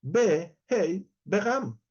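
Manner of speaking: "Be, Hei, Begam" in three slow, separate pieces; alternative Hebrew reading. Shown as a demonstration. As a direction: sound out "Be... Hei... Begam" with pauses. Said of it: "pero podría leer".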